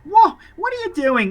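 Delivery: put-on high-pitched child exclamation; high, startled boy voice; startled little boy. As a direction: cry out like a surprised kid. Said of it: "he gets up and he's like".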